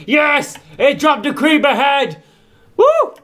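high, loud whoop of victory; triumphant cheer. Real, not acted. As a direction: celebrate the drop out loud. Rare drop finally comes.

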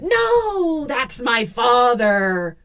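high, drawn-out eerie wail; ghostly wail. Acted. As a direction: wail slowly like a spirit. Ghost voice protests again.

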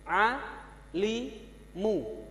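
A, li, mu said as separate syllables, each on its own; teacher modelling syllables; letter by letter. Shown as a demonstration. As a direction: read it slowly one syllable at a time. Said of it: "Cara mengajarnya seperti ini".

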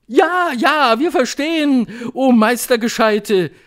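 high, loud eager cry; shouting crowd. A narrator's reading. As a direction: shout back like an adoring audience. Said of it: "schrie das Publikum".

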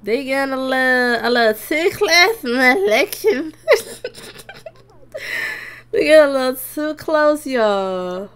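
loud, laughing excitement; excited. Real, not acted. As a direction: squeal at the couple getting close. Sims getting close, excited.

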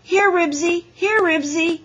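loud, high dog call. A narrator's reading. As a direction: call the dog eagerly by name. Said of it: "he called".

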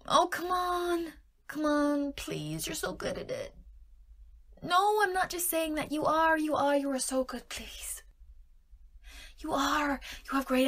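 high, whiny wheedling; butter them up with insistent pleading; coaxing. Pretend. Flattering partner to agree.

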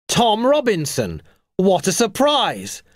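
high, drawn-out exclamation of surprise; surprised cry. A narrator's reading. Bald man meeting Tom unexpectedly on the beach, surprised cry. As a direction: greet an acquaintance with slow, high astonishment. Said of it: "cried the bald man".

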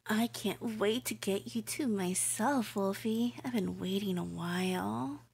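creepy, giddy yandere voice, swinging between coos and hushed whispers; obsessive captor. Acted. Captor coos at tied-up Wolfie, creepy and giddy. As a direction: coo over your helpless captive like a lovesick stalker.

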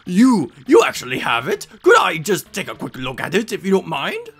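loud, excited stammering start; flabbergasted professor. Acted. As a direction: beg eagerly for a look.

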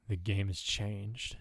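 slow, soft murmur; hushed, drawn-out musing; murmured to oneself. A narrator's reading. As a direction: murmur it slowly, as if privately musing. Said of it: "they murmured to themselves".

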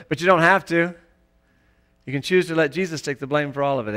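usual speaking voice

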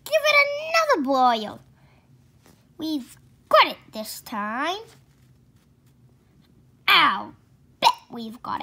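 loud, high, eager cry; bursting with hope, loud; excited. Acted. Father urging George at the boiling pot, loud and excited.

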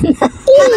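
quick squeal of laughter; short giggle burst; high laugh. Real, not acted. Laughing during challenge. (maikling tawa)

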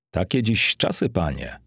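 usual speaking voice

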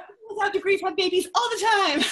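loud, high outburst through laughter; laughing exclamation. Real, not acted. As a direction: call it out loudly, half laughing. Laughing objection to the guest's logic.

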